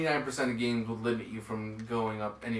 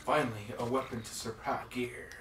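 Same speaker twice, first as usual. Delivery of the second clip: snake voice; 5 dB quieter than usual. Retold or imitated. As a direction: growl it like Solid Snake. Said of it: "Joey's snake voice is much better than mine".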